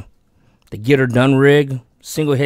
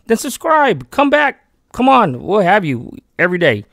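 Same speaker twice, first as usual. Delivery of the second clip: eager urging; 9 semitones higher, 3 dB louder than usual. Real, not acted. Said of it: "urging new viewers".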